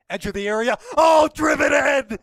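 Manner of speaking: rising into a full roaring shout; excited goal call. Real, not acted. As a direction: build to a huge goal scream. Goal-scoring chance commentary.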